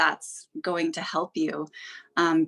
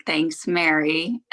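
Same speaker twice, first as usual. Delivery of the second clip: usual speaking voice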